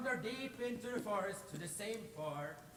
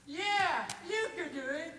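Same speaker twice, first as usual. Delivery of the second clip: high, loud jeering sneer; mock the little brother's big plan; mocking brothers; 11 semitones higher, 5 dB louder than usual. Brothers taunting the youngest, in a high, loud jeer. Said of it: "But the brothers laughed".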